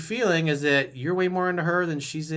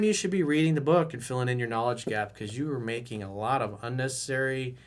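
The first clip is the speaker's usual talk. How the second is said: ordinary delivery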